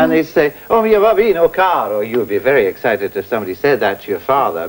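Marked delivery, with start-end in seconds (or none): 0.7-2.0 O mio babbino caro spoken like an Italian on a bus. Shown as a demonstration. Teacher shows how Italians would say the phrase, spoken lively. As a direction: say O mio babbino caro as natural excited speech. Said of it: "exactly as you would say it".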